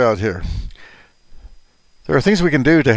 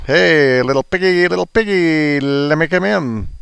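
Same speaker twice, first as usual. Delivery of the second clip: big bad wolf; 7 semitones higher than usual. Big bad wolf at piggy's door.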